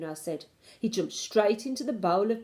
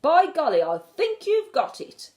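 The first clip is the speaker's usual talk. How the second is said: delighted; 9 semitones higher, 8 dB louder than usual. A narrator's reading. Grandmother delighted by plan.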